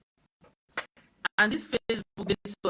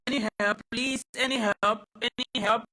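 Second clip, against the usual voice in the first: louder plea to the live viewers, higher; calling for help; 4 semitones higher, 7 dB louder, slower than usual. Real, not acted. Streamer asks viewers for help, raised plea. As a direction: call out to the chat for help, a little raised.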